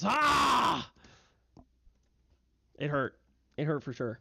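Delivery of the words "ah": high, loud pained snarl; bugbear snarl. Acted. Bugbear wounded by the longsword, loud pained snarl. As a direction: snarl out in pain. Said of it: "snarls at him and goes".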